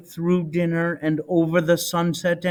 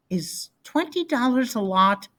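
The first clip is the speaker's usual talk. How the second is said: put-on high, curious little boy; small kid asking wide-eyed; child's voice; 7 semitones higher than usual. Child voice in read story.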